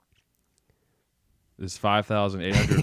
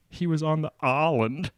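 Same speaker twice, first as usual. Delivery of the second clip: mimicked Southern accent; 8 semitones higher, 4 dB louder than usual. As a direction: imitate a thick Southern "island", pitched high. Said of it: "Whenever you said island, you said".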